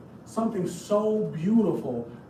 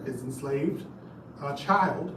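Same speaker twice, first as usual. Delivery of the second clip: usual speaking voice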